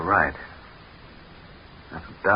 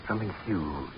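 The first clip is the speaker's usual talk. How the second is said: soft, uneasy report; half-spoken, unsettled; hushed unease; 8 dB quieter than usual. Acted. Uneasy sighting in the dark.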